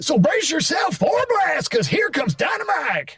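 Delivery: cackling mad bomber hype; high, slow shout to close; Dynamite voice. Shown as a demonstration. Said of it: "that was Dynamite".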